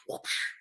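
soft, airy whoosh; whoosh effect. Acted. Storyteller closing the chapter, soft whoosh. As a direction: breathe out a quick swish.

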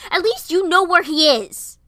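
high, very loud retort; angry shout. A narrator's reading. Friends argue over their missing dads, shouting. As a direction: shout it back at full force. His anger returning.

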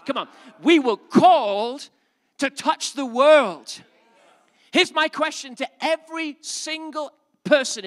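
high, loud, excited pulpit cry; fervent preaching. Acted. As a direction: whip the congregation up with joy. Preaching climax.

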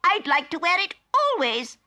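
squeaky very high little creature voice; Bert the Tumbledowny. Acted. Bert greets Polly innocently, squeaky very high. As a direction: squeak it out cheerily.

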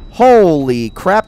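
slow, loud, drawn-out amazement; loud astonished reaction; amazed exclamation. Real, not acted.

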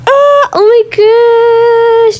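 slow, high amazed oh my gosh; high drawn-out delight. Real, not acted. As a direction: drag it out in wonder. Discovering a new item.

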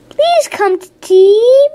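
high, loud, slow calling out. Acted. Miss Spider calling loudly to the bees. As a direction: call them in to tea. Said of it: "Miss cried".

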